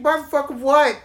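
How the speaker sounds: high, loud exasperated yell; mock yell at car. Driver answering his beeping car, loud and exasperated. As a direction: shout back at the beeping car.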